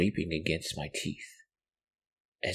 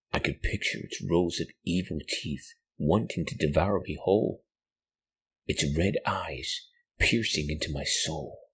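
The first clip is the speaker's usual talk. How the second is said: ordinary delivery, nothing marked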